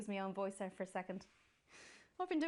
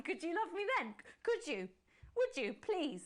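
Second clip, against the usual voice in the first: higher, pleading and urgent; desperate plea; 9 semitones higher than usual. Acted. Host begging Alex to love her. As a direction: beg him, nearly hysterical.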